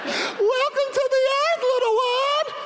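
put-on very high cooing baby talk; doting new parent welcoming a crying baby. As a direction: coo it to a newborn. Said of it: "newborn parents ... going".

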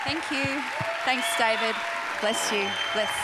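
normal talking voice

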